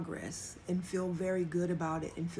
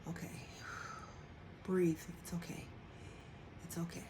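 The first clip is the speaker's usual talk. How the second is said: calming whisper; 2 semitones lower, 11 dB quieter than usual. Retold or imitated. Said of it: "and I can be like".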